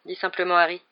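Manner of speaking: plain reading voice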